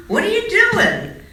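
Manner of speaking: excited caterpillar asking; eager question. A narrator's reading. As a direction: ask it eagerly, up high. Charlie asks the rabbits.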